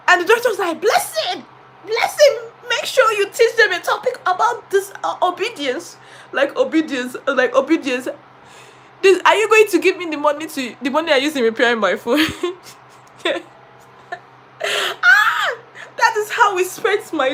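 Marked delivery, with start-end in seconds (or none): 0.8-6.0 high director voice. Retold or imitated. Director voiced in a retold story. 14.6-15.6 anguished cry. Real, not acted. Anguished cry over broken phone.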